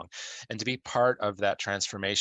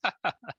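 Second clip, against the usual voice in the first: short laugh; 10 semitones higher than usual. Real, not acted. Laughs after saying thank you.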